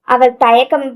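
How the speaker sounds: plain reading voice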